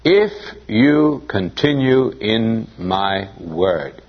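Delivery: "If you continue in my word." spoken very slowly, "if" stressed. Shown as a demonstration. Preacher pointing out the qualifying phrase, very slowly. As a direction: draw out the condition, word by word. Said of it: "it's that qualifying phrase, isn't it?".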